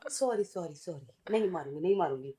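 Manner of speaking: soft, low backing-down apology; meek apology. Pretend. Teasing mum backs down to her little boy, meek soft apology. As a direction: drop low and quiet to placate.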